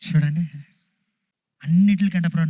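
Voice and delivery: normal talking voice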